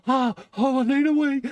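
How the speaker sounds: high, loud squeaky plea to go; hammed-up little boy needing the loo; mock desperate wee voice. [name] teasing Dave about his loo dash in a squeaky mock voice.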